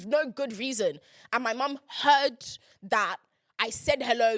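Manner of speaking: ordinary talk, nothing marked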